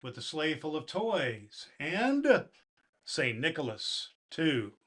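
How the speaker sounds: put-on storybook reading to children; gentle bedtime poem reading; story narration. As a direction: read the Christmas poem warmly to kids. Santa reads the poem aloud.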